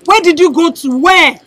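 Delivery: pressing question. Acted. Mother pressing the daughter.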